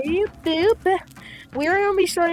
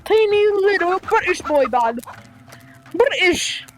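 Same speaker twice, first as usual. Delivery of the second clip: higher, playful fake British accent; mock British accent; 4 semitones higher, 4 dB louder than usual. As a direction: ham up a shaky attempt at British. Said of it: "Maybe I sound like an Irishman or Scottish".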